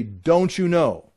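drawn out slowly, each word weighed; slow emphatic question. Shown as a demonstration. Teacher quoting Paul's refrain to the class, slow and weighty. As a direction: let the question hang, slowly. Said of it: "Ten times".

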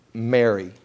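low, simple falling call of a name; simply said name. Retold or imitated. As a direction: say the name simply, pitched low, letting it fall. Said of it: "he just simply says".

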